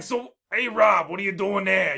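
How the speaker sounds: big, high, loud cartoon voice with Brooklyn accent; cartoon Brooklyn pig. Shown as a demonstration. Voice actor demonstrating his pig gig for the co-host, loud cartoon Brooklyn pig. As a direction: holler it as a hammed-up Brooklyn pig. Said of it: "so I ended up with".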